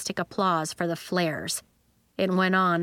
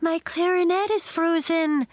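high-pitched distressed wail; 9 semitones higher than usual. A narrator's reading. Marigold over her frozen clarinet, wailing. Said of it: "Marigold wailed".

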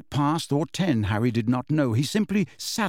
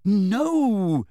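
sharp higher no; angry; 7 semitones higher than usual. A narrator's reading. Harry angrily denying to McGonagall, angry. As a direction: reject it angrily. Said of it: "said Harry angrily".

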